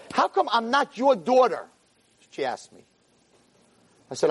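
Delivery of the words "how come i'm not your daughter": high, slow, insistent question; insistent plea. Retold or imitated. Girl asks rabbi why she isn't his daughter, insistent plea. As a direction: press the question, stretching it out.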